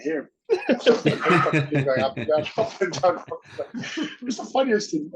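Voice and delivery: words broken by real laughter; laughing while telling. Real, not acted. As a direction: chuckle through the story of the prank. Laughing while retelling helicopter prank.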